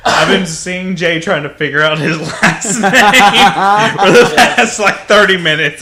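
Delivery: high, loud, slow, laughing. Real, not acted. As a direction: laugh through it loudly. Laughing at Jay's struggle.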